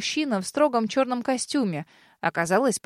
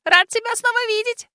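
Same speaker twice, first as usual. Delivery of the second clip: very high, louder happy squeak; little dragon delighted to see Den; delighted tiny dragon; 12 semitones higher, 5 dB louder than usual. A narrator's reading. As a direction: greet an old friend joyfully, dragon-small. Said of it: "обрадовался чешуйчатый".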